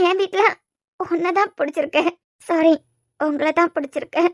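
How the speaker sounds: halting speech through tears; crying. Acted. Asks why he hurts her with questions, crying. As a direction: protest tearfully, in broken phrases. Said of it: "முத அழுறத நிப்பாட்டு".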